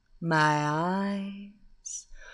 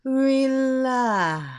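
"relax" louder and higher, falling firmly; firm hypnotic command; 4 semitones higher, 5 dB louder than usual. Acted. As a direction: push "relax" out strong. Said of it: "my hypnotic voice".

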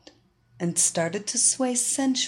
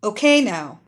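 loud, firm police officer; cop voice; 2 semitones higher, 7 dB louder than usual. Acted. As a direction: sound like a cop on duty. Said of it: "in her best copyese".

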